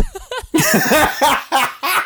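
loud high laughing fit; real laughter at a memory; high burst of laughter. Real, not acted. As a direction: crack up loudly. Laugh remembering wrestling sighting.

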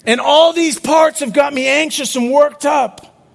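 building sermon climax; preaching at full force. Acted. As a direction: lift the congregation with rising urgency. Preaching climbs in pitch.

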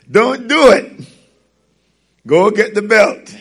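put-on mother sending the kid for the belt, stern. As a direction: send the kid for the belt, firmly.

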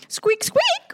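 very high tiny squeaks; mouse squeaks. Acted.

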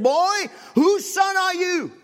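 put-on a towering preacher calling down to a frightened boy; booming preacher. Tall preacher questions the boy.

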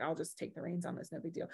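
low, very soft, offhand; retold reassurance. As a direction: play it down quietly as someone else. Sister-in-law's reassurance retold.

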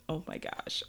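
normal talking voice

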